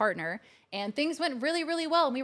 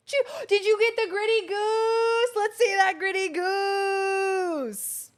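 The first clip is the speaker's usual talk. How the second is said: high, loud, long-drawn wail; hyped-up hollering; 9 semitones higher, 6 dB louder, much slower than usual. Pretend. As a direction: holler it high, stretching the last vowels way out. Hollers for hype train emote.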